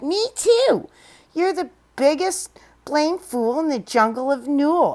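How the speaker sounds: high little joey voice, teasing; young kangaroo. Acted. Joey in pouch mocking Horton, high and teasing. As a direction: squeak it out like a cheeky baby kangaroo. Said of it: "the young kangaroo in her pouch said".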